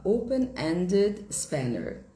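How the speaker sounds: open-ended spanner drawn out very slowly; teacher repeats the British term slowly; slow British demo. Shown as a demonstration. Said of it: "No inglês britânico".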